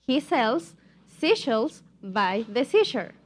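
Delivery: slow tongue twister in separate chunks; slow demonstration. Shown as a demonstration. Teacher models for students to repeat, slowly. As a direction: space out each phrase for repetition. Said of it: "pero un poco más rápido".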